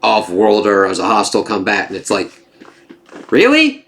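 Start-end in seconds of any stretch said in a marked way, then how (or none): 0.0-2.0 lower, mock official voice of a paranoid bigot; xenophobic Richard. Richard imitated while announcing every off-worlder is a hostile combatant. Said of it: "goes fucking complete xenophobic fucknutter". 3.3-3.8 much higher, sharp disbelieving really?; incredulous. Real, not acted. Reacting in disbelief to Richard's order, incredulous.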